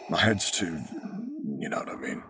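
fading to a raspy whisper; cold hissing layered whisper. A narrator's reading. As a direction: breathe it out, barely voiced. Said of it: "hissing layered whisper".

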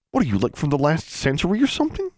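high, incredulous jeer; scornful disbelief. A narrator's reading. David mocks the old-fashioned boy, high scornful disbelief. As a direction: jeer with the pitch climbing.

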